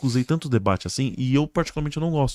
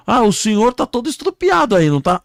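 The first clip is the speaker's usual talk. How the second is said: very high, loud exclaimed question; rescuer questioning; 11 semitones higher, 6 dB louder than usual. Acted. Man questioning a crash victim, high and loud. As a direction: exclaim at the obvious injuries. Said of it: "o homem chegou pra ele e falou".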